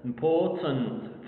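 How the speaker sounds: "Important" slow, with a schwa before nt; three full syllables. Shown as a demonstration. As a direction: model "Important" keeping the vowel in its last syllable. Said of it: "three syllables".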